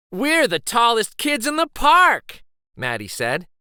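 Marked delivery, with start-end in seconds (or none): 0.1-2.4 high and loud, bursting with pride; excited cheer. Acted. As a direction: shout it like a thrilled kid on top. Kids cheering on top of structure.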